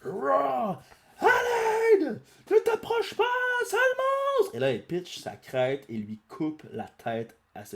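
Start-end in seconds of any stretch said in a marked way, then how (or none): 0.0-0.8 high cartoon dinosaur roar; monster roar. T-Rex roars. 1.2-4.6 very high, loud panicked yelling; frightened girl shouting. Acted. Girl chased by T-Rex.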